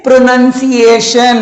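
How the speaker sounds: प्रोनंसिएशन slow, "nun" not "noun"; correct pronunciation. Shown as a demonstration. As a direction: lead the class in saying प्रोनंसिएशन slowly as pro-nun-si-ay-shun. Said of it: "मेरे साथ बोलिए".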